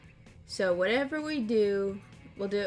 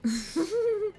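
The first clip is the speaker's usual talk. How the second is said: high squealing laugh; 12 semitones higher than usual. Real, not acted. Reacting to a joke.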